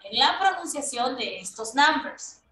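ordinary talk

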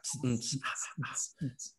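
soft hissing exhales like a lifter straining; quiet mock weightlifter hiss; hissed lifting breaths. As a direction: imitate the tss tss of heavy reps, whispered.

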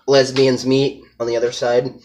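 ordinary talk, nothing marked

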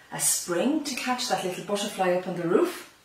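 plain reading voice

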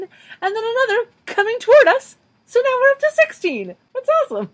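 high-pitched gleeful tally; excited. Real, not acted. Host counting streetcars up to sixteen, high excited voice. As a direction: announce it way up high with delight.

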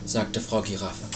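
ordinary delivery, nothing marked

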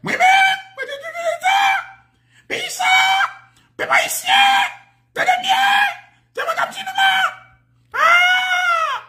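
very high, drawn-out shouts; hammed-up falsetto wailing to the nation; mimicked screaming. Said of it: "la vini dit". (rèl byen wo, trennen, pou imite)

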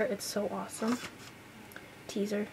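ordinary talk, nothing marked

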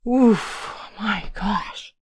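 out of breath from the scare; panting. Real, not acted. Player catching her breath after running in game, panting. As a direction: puff it out after a panicked chase. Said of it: "I don't know why I'm panting".

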